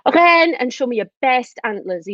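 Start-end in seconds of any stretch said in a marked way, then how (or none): 0.0-0.5 "Reine" called out high and loud; demonstrated shout. Shown as a demonstration. Teacher showing children what to shout, demonstrated shout. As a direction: give "Reine" a short raised shout. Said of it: "I want you to shout".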